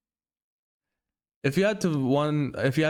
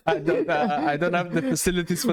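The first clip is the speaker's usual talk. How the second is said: higher, amused protest; laughing refusal; 6 semitones higher than usual. Real, not acted. Co-host begs off the Korean film line, laughing.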